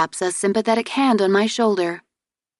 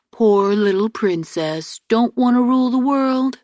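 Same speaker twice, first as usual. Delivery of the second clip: mock pity; 4 semitones higher, 4 dB louder than usual. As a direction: tease her like a sulky child. Brother teasing with a pout.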